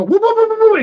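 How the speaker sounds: high mock Three Stooges whoops. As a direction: whoop it like Curly, going round in circles. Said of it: "and he'd go".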